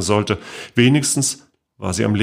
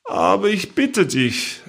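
higher, slow, cheerful aunt voice; 10 semitones higher, slower than usual. A narrator's reading. Great-aunt brushing off the accusation, cheerful and higher. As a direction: breezily wave it off. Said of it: "erwiderte sie fröhlich".

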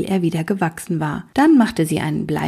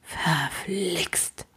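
grumbled; 9 dB quieter than usual. Acted. Lukas grumbles a soft curse to the king. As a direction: grumble it under the breath. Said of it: "brummte Lukas".

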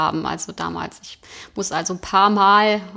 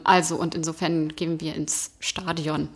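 normal talking voice

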